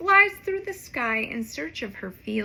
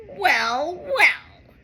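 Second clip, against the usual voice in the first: louder sneering villain dog; angry dog; 3 semitones higher, 6 dB louder than usual. Acted. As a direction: gloat with menace. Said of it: "says the angry dog".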